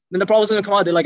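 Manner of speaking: ordinary talk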